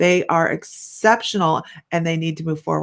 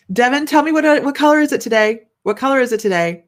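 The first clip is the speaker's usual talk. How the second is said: high, loud, eager call to a viewer; excited call-out; 6 semitones higher, 5 dB louder than usual. Real, not acted. Host calling to viewer Devin, high and bright. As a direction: sing it out to grab Devin's attention.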